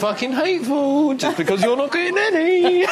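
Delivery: high, loud-ish English indignation, played for laughs; hammed-up English. Englishman scolding a jealous jock, hammed-up English accent. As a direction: plead in an exaggerated English accent, pitched right up. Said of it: "That's like your man from Love Actually".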